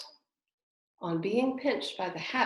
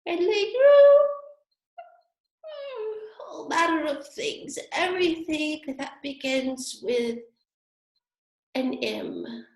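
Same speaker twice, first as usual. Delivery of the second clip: drowsy Dormouse; 10 semitones higher, 6 dB louder, slower than usual. A narrator's reading. Sleepy Dormouse continues.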